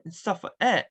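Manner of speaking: ordinary talk, nothing marked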